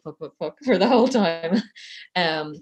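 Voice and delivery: usual speaking voice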